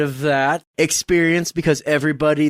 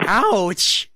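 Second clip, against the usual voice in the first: drawn-out, higher playful ouch; milk the pretend pain; theatrical wounded ouch; mock pained; 5 semitones higher than usual. Mock pain after the jab.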